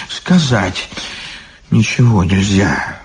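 plain reading voice

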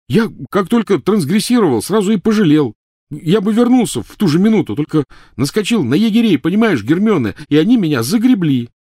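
high, loud yell. Acted. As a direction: bellow it back at her. Ron pleading loudly.

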